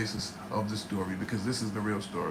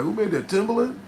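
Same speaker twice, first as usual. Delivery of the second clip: higher, lightly mocked awe; impressed listener; 8 semitones higher, 4 dB louder than usual. Imitating a listener amazed by his beats, higher and a bit louder. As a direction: play a stunned fan asking who made the beat. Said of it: "you be like".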